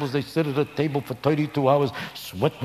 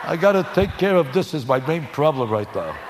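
God brushing off; 4 semitones higher than usual. Pretend. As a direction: shoo them away as a busy God. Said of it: "Could you picture God saying to them".

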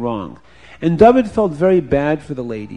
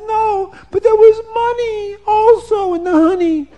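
high, whiny woman's voice on the edge of tears; upset woman; 6 dB louder than usual. A narrator's reading. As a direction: wail it as the wronged woman. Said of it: "And the woman says".

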